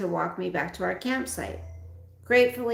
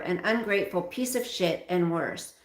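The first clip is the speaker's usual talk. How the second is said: plain reading voice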